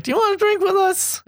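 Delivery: very high sing-song offer; sweet invitation. Retold or imitated. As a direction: squeak out a friendly invite to drink. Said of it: "I was just like".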